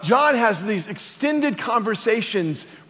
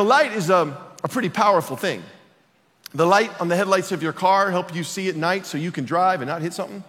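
ordinary talk, nothing marked

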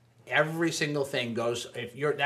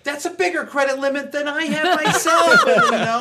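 laughing disbelief; 12 semitones higher, 9 dB louder than usual. Retold or imitated. Incredulous reaction to fraud.

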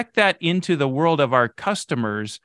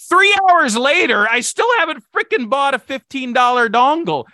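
high, loud exasperated rant, slow at the start; frustrated outburst; 10 semitones higher, 4 dB louder than usual. Retold or imitated. Buyer fed up after hours shopping for a dongle, loud exasperated rant. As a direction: vent like hours were wasted on a cable. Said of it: "now I'm getting really frustrated".

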